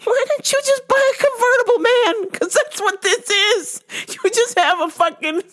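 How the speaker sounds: very high, sarcastic ranting; mock the car in a squeaky exasperated voice; ridiculing dad's useless Sequoia; mocking outrage.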